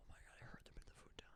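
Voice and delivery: rushed excited whisper; fast whisper. Real, not acted. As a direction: whisper it quickly. Hushed talk about delivery arriving.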